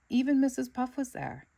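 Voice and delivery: ordinary delivery, nothing marked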